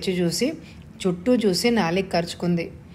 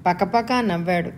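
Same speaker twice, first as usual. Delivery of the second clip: plain reading voice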